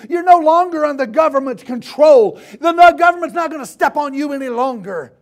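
high, loud shouted proclamations; fired-up, repetitive pulpit shouting; forceful preaching. Real, not acted. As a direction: hammer each declaration home at full force.

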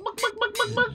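high clucking bawk; high chicken cluck. Pretend. Gamer turned into a chicken, clucking high.

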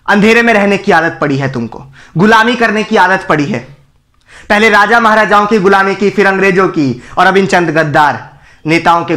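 ordinary talk, nothing marked

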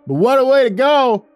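high, loud heckle; whooping shout over the crowd; calling out. A narrator's reading. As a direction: holler it from the back. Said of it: "called out in the background".

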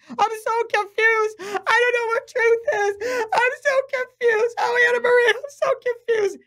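high, tearful mock whimper of a lost follower; preacher mimics a confused follower, voice high and teary; imitating confused crying. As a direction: play a weeping, bewildered churchgoer. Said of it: "I have people coming to me crying".

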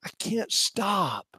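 slow whispered confession. Acted. Jeremiah admitting to God in a whisper. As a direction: breathe it out, spent. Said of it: "whisper after fire in belly".

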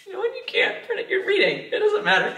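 high squeaky laugh through words. Real, not acted. Laughing after the clip.

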